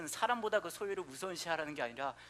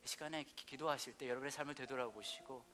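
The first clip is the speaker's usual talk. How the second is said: quiet, hushed prayer over music; gentle pastor's prayer with whispered lines; soft closing prayer; 3 semitones lower, 4 dB quieter than usual. Acted. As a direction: draw the room into prayer.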